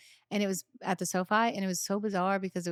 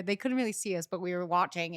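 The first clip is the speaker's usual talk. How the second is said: ordinary talk, nothing marked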